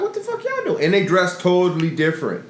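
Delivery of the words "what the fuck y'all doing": high, startled squeal of disbelief; shocked disbelief. Retold or imitated. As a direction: jump way up in pitch, caught off guard. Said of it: "And I'm like".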